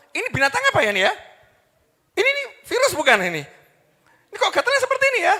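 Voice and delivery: high, fast, hammed-up panic; mock alarm. Preacher acting someone alarmed by an itchy hand, mock alarm. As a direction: rattle off worried questions high and loud.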